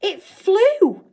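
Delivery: high, loud gasp of wonder; can't believe what she saw; amazed. A narrator's reading. Girl watching the beetle fly, amazed. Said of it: "Novak said, amazed".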